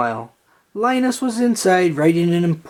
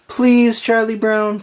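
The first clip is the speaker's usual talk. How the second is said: pleading; 9 semitones higher, 4 dB louder than usual. A narrator's reading. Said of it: "pleaded Lucy".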